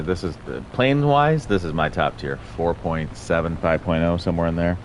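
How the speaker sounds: ordinary talk, nothing marked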